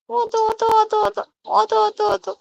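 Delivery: high, bright urging call; high coaxing call. Pretend. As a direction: lift the voice up high to coax. Coaxing fallen toy louder.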